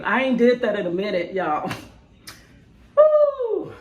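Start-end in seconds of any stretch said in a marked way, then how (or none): 3.0-3.7 whoop. Real, not acted. Cheering after her performance.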